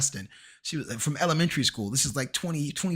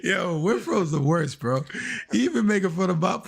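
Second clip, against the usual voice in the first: laughing; 6 semitones higher, 6 dB louder than usual. Real, not acted. Cracking up about the show.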